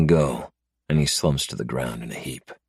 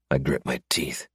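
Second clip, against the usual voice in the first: ordinary delivery, nothing marked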